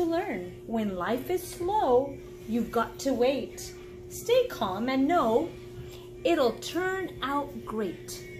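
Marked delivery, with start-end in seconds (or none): none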